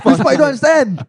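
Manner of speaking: high, loud scolding; exasperated outburst. Real, not acted. Scolding co-hosts for missing the story's point, exasperated. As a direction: pitch leaping up in exasperation.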